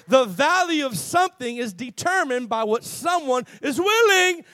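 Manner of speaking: loud, high sermon delivery building to a shout; preaching. Real, not acted. Preacher rousing the congregation with a principle, preaching loudly. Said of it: "I'm gonna preach here".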